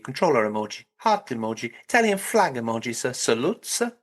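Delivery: hammed-up Italian accent with sing-song phrase endings; mock Southern Italian accent. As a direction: Englishman struggling through an Italian accent. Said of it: "most perfect Southern Italian accent".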